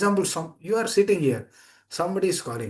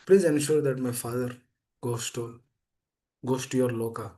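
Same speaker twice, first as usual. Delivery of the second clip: praying; 4 semitones lower than usual. Acted. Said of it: "he is praying to Lord Nrsimha".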